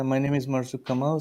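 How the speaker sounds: usual speaking voice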